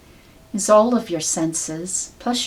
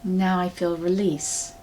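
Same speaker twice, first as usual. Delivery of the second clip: ordinary delivery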